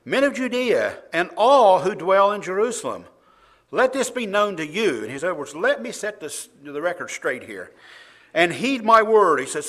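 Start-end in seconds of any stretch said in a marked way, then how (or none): 0.1-5.0 loud, high proclamation to a crowd; raised voice. Acted. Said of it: "he raised his voice and said to them". 5.5-9.4 insistent, raised-voice address. Acted. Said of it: "he raised his voice and said to them".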